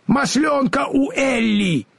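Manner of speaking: high, drawn-out wail of alarm; alarmed high Scarecrow cry; frightened cry. A narrator's reading. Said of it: "в испуге закричал Страшила".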